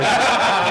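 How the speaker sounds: a short burst of real laughter; amused laugh. Real, not acted. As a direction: laugh it off, caught out. Laughs at his own secret.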